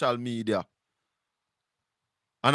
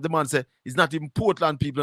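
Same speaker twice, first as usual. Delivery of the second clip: ordinary delivery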